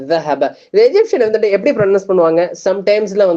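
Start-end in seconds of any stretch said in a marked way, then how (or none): 0.1-0.5 ஸஹப starting with voiced "th" as in this; tongue under upper teeth to start ஸஹப; standard Dhal. Shown as a demonstration. Teacher showing standard Arabic word, ஸஹப with voiced TH. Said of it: "அந்த ஜால் வருது".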